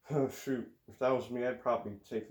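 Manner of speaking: ordinary delivery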